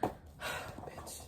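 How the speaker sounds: sigh, then a whispered curse; barely audible, all breath; exasperated whisper under a sigh. Real, not acted. Sighing, tired of the lesson.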